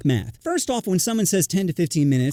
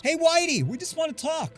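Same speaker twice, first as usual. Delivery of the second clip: mock call out; 11 semitones higher, 4 dB quieter than usual. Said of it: "one of them yells".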